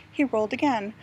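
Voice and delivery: ordinary delivery, nothing marked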